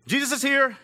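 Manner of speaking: dramatic reveal. Acted.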